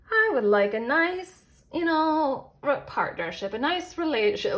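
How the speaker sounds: dreamy wish. Retold or imitated. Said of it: "sorry, I'm thinking of romance".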